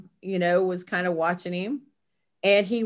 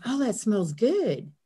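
a light imitation of a boy pleased by a scent; imitating grandson; 3 semitones higher than usual. Grandmother lightly imitates grandson smelling the oil. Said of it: "Like that".

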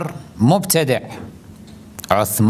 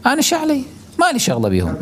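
a much higher, whiny mock of the indifferent believer; mocking excuse; 11 semitones higher, much faster than usual. Preacher mocking a believer who avoids disavowal, whiny and high. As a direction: squeak it out like a lazy excuse-maker. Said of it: "يقول".

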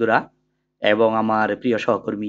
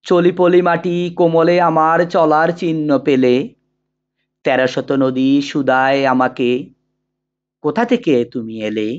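measured, sustained poetry recital with drawn-out phrase endings; poem recitation; 3 semitones higher, 4 dB louder, slower than usual. Acted. Teacher reciting the poem Amar Porichoy for students, in measured declamation. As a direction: declaim the verse slowly and evenly, pausing between couplets.

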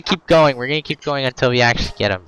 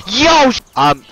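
panicked; 10 semitones higher than usual. Real, not acted. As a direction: yell for help while being chased. Chased by sheep in game.

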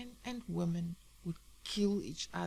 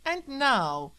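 loud, high radio host opener; bellowing radio announcer; 7 semitones higher, 6 dB louder than usual. Acted. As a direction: boom it out like a late-night DJ. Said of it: "bellowed the radio".

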